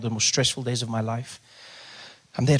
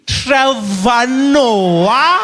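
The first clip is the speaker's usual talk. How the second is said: very slow, high, rising disbelief; slow realization; 9 semitones higher, 10 dB louder, much slower than usual. Retold or imitated. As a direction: stretch it out as it dawns on you.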